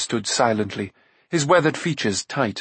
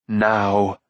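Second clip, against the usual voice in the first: understated harsh order; imperious one-word command; 2 semitones lower than usual. A narrator's reading. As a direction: demand it coldly, without raising the voice. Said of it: "she commanded harshly".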